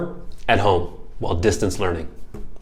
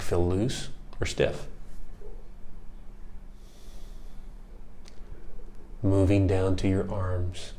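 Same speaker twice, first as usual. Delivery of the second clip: guided meditation; 2 semitones lower, 10 dB quieter than usual. Acted. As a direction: guide them gently into calm, unhurried. Leads a body scan.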